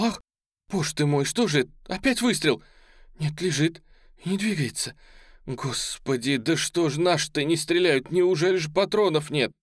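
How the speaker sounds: high-pitched alarmed exclamations; anxious onlookers. Acted. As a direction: fret aloud as you watch. Crowd anxiously watches soldier under fire.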